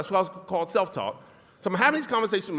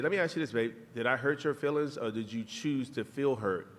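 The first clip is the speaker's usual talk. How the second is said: calm question; 8 semitones lower, 6 dB quieter than usual. Retold or imitated. Husband calmly questions hurt wife.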